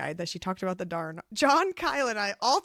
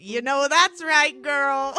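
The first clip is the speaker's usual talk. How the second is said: loud, higher, drawn-out cheer; hyped cheering on; 5 semitones higher, 8 dB louder, slower than usual. Retold or imitated. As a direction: egg her on loudly. Acting out own reaction to book.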